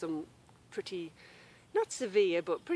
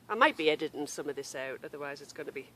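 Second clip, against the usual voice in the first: normal talking voice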